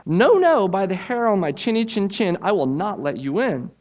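high, squeaky pig refusing; the little pig digs in, high; defiant little pig. Acted. Little pig refusing the wolf, high defiant voice.

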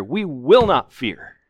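slow, loud punch on "will", pitch leaping up; emphatic declaration. Shown as a demonstration. Preacher declaring the psalmist's confidence, emphatic stress on "will".

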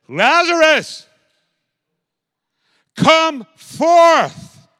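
very high, loud, slow ringing command; loud commanding call. Acted. Jesus crying out to dead Lazarus, loud and commanding. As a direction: call the dead man out of the tomb. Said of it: "he said it with a loud voice".

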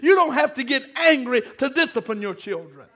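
ordinary delivery

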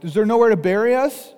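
high, loud panicked cry; panicked whine. Retold or imitated. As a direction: mimic a frightened crowd shrieking at Moses. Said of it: "the way that they're speaking".

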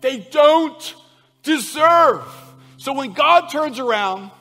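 thundering sermon voice; full-force preaching. Acted. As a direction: preach it out with everything you have.

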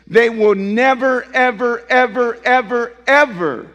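loud, punched "never" and then each "ever" struck alike; hammered never ever. Acted. Pastor insisting to listeners, loud punched repetitions. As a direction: drive home each "ever" in a row.

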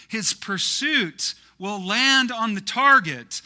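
loud, high, slow proclamation; impassioned preacher reciting verse; poem declamation. Acted. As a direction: drive each phrase home at full voice. Recites poem at full force.

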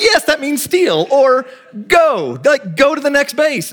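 high, drawn-out, emphatic explaining; exasperated coach. Retold or imitated. Coach explaining to a confused kid what steal means, exasperated. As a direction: spell it out in exasperation, pitched high.

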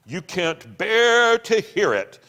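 loud, slow, bitter shout; shouting in miserable triumph. A narrator's reading. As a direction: shout it out, gloating in his misery. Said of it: "he shouted with miserable triumph".

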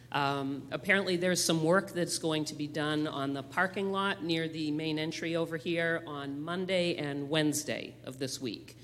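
ordinary talk, nothing marked

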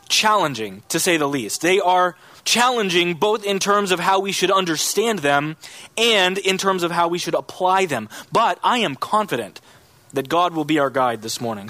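ordinary delivery

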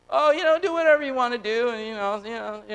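high, fast, dismissive mock voice; sarcastic parody of a shrugging husband; mocking Elkanah. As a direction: brush it off like a spineless husband. Mocking husband's lax advice.

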